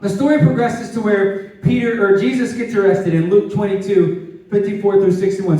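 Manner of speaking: ordinary talk, nothing marked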